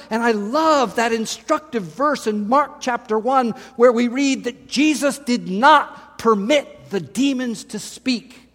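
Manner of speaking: usual speaking voice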